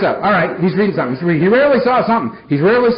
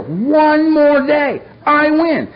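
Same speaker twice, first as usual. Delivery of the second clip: triumphant; 8 semitones higher, much slower than usual. Real, not acted. Triumphant over sobriety.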